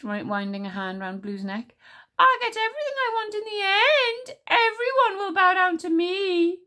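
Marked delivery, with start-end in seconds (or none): 2.2-6.6 high, loud villain's cry; screeching Ice Queen. A narrator's reading. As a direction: screech it triumphantly, far above your own voice. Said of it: "the Ice Queen screeched".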